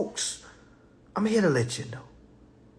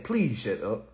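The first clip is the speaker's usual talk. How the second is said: softer polite; 8 semitones lower, 6 dB quieter than usual. Retold or imitated. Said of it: "tell her I said".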